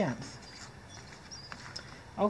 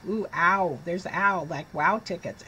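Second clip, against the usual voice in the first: plain reading voice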